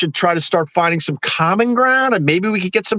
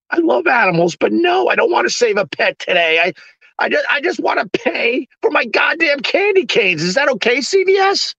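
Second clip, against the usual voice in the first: mock rant; 7 semitones higher than usual. Ranting at checkout charity ask.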